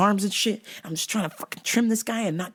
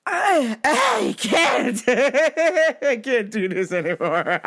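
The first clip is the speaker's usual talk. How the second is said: higher cry breaking into laughter; laughing despair; 4 semitones higher, 3 dB louder, slower than usual. Real, not acted. Pet owner fed up with mess, laughing helplessly. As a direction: crack up while giving up.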